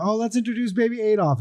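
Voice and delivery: high, showy announcing voice; proud host voice. Retold or imitated. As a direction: present the baby like a proud host. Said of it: "They're about".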